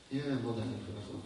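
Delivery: low, soft, dragging mumble; depressed prayer. Pretend. Girl after a bad night mumbling prayer, low and soft. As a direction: say it like you can barely get up. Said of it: "you had a bad night".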